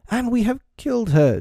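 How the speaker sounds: put-on high hoarse boy's voice. A narrator's reading. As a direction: rasp it out, hoarse with shock. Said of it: "Nib said hoarsely".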